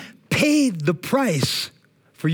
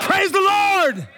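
high, slow shout of praise the Lord; full-voiced praise shout; slower than usual. Shown as a demonstration. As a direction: let it ring out loud. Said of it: "praised God with a loud voice".

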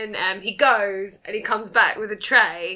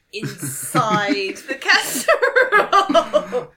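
proud Q; 4 semitones higher, 5 dB louder, slower than usual. Acted. Q presenting gadget proudly.

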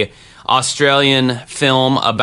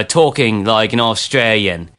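usual speaking voice

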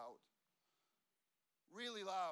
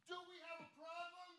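much higher, strained mock confrontation; confrontational challenge; 11 semitones higher, 5 dB quieter than usual. Pastor challenging a complaining stranger in a restaurant, high-pitched confrontation. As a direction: call out a challenge that stops the room. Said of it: "I go just like this".

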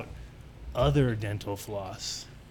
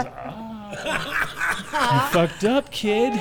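gloating principal; 9 semitones higher, 3 dB louder, much slower than usual. Retold or imitated. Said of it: "and he goes".

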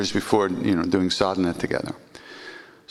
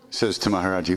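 ordinary delivery

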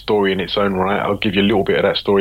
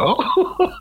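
surprised oh. Retold or imitated. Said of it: "It's like".

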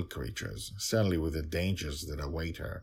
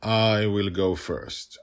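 louder, slow and firm; a touch of a strong voice; 3 semitones higher, 8 dB louder, slower than usual. Acted. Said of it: "I heard a strong voice".

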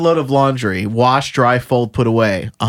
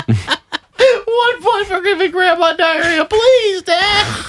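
put-on high, slow, wailing old lady; slower than usual. Kid begging Dad for one point, old lady voice. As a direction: plead in a high, quavering old-lady whine. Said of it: "That was an old lady voice".

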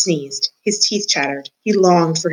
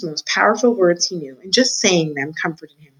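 usual speaking voice

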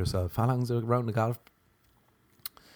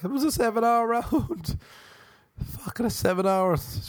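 laughing golfer; 11 semitones higher than usual. Acted. Ten years married golfer.

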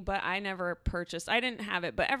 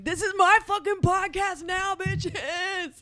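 louder, higher mock-villain gloat; 10 semitones higher, 5 dB louder, slower than usual. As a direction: crow like a triumphant villain. Gloating alone in the studio.

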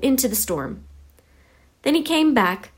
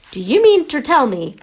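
angry, raised growl; higher, louder indignant growl; 5 semitones higher, 5 dB louder than usual. A narrator's reading. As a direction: growl it as an accusation. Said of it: "he growled at the Dursleys".

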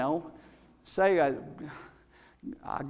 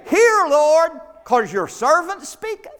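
louder and higher, pushy hear Lord; mock bossy prayer; 8 semitones higher, 6 dB louder than usual. Shown as a demonstration. Preacher mocking how we pray, loud and demanding. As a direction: boss God around, tongue in cheek. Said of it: "See, we've got that mixed up".

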